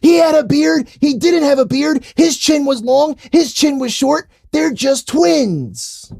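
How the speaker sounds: high, pushy know-it-all voice; mock Twitter gloat. Telling Twitter he was right, high mocking voice. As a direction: squeak it like a smug fan. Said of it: "I will say".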